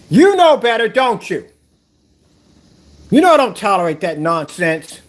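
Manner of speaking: loud stern telling-off to the cat; scolding the cat. Real, not acted. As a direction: tell the cat off firmly.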